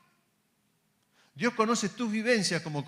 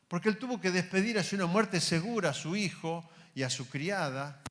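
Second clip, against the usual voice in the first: usual speaking voice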